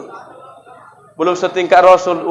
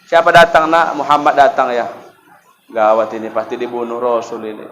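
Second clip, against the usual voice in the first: hushed worry, dropping lower and softer; acted fearful; 6 semitones lower, 4 dB quieter than usual. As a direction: play mild dread, sinking almost under the breath. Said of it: "udah gemetar ketakutan".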